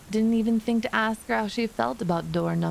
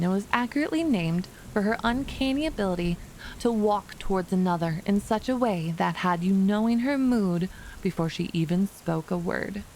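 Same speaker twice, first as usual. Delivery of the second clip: usual speaking voice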